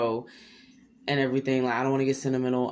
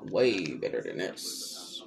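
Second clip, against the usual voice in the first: low slow emphatic; 10 semitones lower, 4 dB quieter, slower than usual. Shown as a demonstration.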